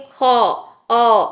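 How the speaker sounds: خا said full and unrounded, long open aa; correct heavy kha. Shown as a demonstration. Teacher modelling the right heavy kha, slow. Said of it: "تو کیا ہونا چاہیے؟".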